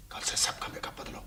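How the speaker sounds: low-voiced, whispered instruction; hushed order. Boss quietly telling his man to change makeup, whispering. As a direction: whisper it as a private command.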